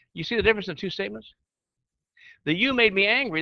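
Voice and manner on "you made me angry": louder, higher mock accusation; a pointed "you made me angry" with a hard fall on "made"; accusing tone. Shown as a demonstration. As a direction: snap out the blame. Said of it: "that's accusative".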